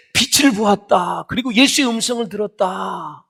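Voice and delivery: high, loud voicing of an apostle's testimony; fervent proclamation. Preacher voices Paul's fervent testimony.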